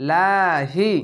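"লাহি" with a light, thin লা; chanted "লাহি", thin lam and long vowel. Shown as a demonstration. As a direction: draw out লা without thickening it. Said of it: "তার জন্য পাতলা করা হবে".

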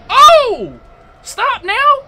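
high, loud yelps of protest; high-pitched exasperated shouting; frantic protest. Real, not acted. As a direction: cry out as the hits keep landing. Protesting as boss hits player.

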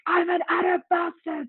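high-pitched indignant retort; mock outrage, pitched well up; livid dad. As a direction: act out an outraged dad snapping back. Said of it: "turned around so livid".

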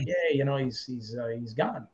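imitating a friend barely managing bad news; quiet, hesitant, a touch upset; struggling to say it. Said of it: "didn't have the strength to say it".

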